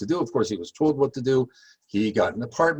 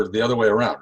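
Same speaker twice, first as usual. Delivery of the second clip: ordinary delivery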